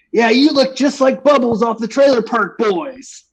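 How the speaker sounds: high-pitched loudmouth announcement; mock loudest coworker. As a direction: imitate a loud coworker crowing it out. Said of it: "tells the most loudest person that works".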